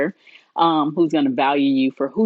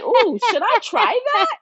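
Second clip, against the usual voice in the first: high-pitched, drawn-out wondering; playful high musing; tempted self-quote. Acted. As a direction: act out a tempted "ooh" up high. Acting out own tempted thought.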